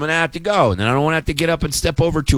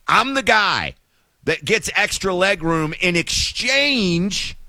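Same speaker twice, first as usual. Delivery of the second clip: ordinary delivery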